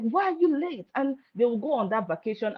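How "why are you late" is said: angry demand. Retold or imitated. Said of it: "he'll be so angry".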